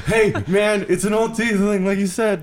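high character voice. Acted. Player voices character reporting.

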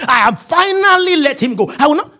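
high-pitched victorious declaration; hammed-up self-congratulation; mock triumph. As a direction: insist on it with exaggerated resolve. Acted triumphant wife talking to herself.

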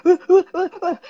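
high, loud mock panicked "ah, ah, ah"; inner panic cry. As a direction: play the frantic voice inside a parent who pretends to be calm. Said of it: "inside going".

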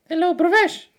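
very high, loud squeal of a name; high-pitched prank-call voice; prank caller. Acted. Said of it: "and I'd go".

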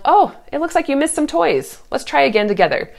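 high, cheerful, fast coaxing; sweet prompt. Shown as a demonstration. Mom nudges her five-year-old to tidy toys, sweetly. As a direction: coax a small child sweetly. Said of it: "if you say to your five-year-old".

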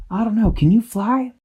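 high fairy voice, unsure; worried fairy. A narrator's reading. Tweak answering Dashy, anxious. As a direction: wonder aloud, anxious.

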